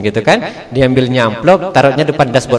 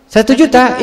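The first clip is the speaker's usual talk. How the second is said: shocked; 8 semitones higher than usual. Retold or imitated. Husband stunned by the amount.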